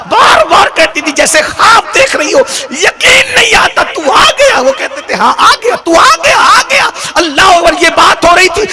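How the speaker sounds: loud, high impassioned preaching with tearful laughs; preaching at full force. Acted. Preacher telling the reunion to the gathering, full force. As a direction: preach it at the top of the voice, overcome. Climax of the sermon story.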